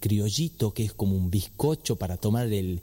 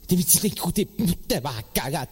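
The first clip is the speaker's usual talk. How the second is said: mother's threat; 4 semitones higher, slower than usual. Retold or imitated. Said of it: "te decía".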